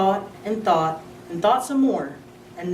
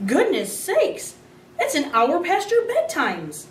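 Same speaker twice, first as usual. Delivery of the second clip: high, slow startled cry; mother startled at how late it is; startled exclamation; 9 semitones higher, slower than usual. A narrator's reading. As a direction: realise the time with alarm. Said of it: "Missus Thomas gasped".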